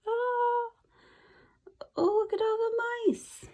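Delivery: high, soft fretful teacher; teacher sweeping up the mice, dismayed; lightly voiced teacher. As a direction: coo it with dismay.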